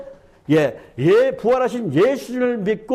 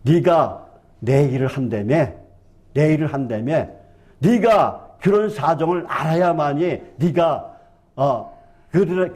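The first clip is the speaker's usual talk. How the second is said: voicing the Lord; 7 semitones lower than usual. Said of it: "주님께서 뭐라고 하신 줄 아세요".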